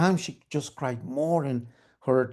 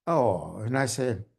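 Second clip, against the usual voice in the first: plain reading voice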